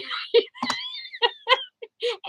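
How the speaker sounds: a squealing laugh that breaks high; high burst of laughter. Real, not acted. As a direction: laugh up high, almost crying. Laughing at husband's joke.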